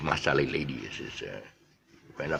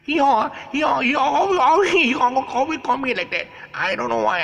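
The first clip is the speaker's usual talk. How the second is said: very high, loud braying voice; donkey-like voice; 7 dB louder than usual. Retold or imitated. Wife answering the waiter, loud, high and braying like a donkey. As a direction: bray the words like a donkey. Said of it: "she sound like donkey".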